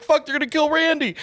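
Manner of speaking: very high-pitched retold alarm; mock panic. High storyteller watches agents spot armed Randy, mock panic. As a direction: act out fearing for a coworker's life. His fear for the armed guard.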